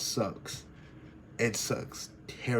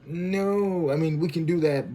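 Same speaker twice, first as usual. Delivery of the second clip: higher-pitched pleading "no"; pleading protest; 7 semitones higher, 4 dB louder than usual. Retold or imitated. Acts out pleading reply to rejection.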